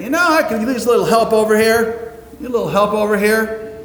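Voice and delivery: high, pointed hint for help; put-upon thought. Retold or imitated. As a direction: sigh it out like you're stuck doing all the work. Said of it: "And you're thinking".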